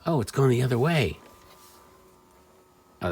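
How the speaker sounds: ordinary talk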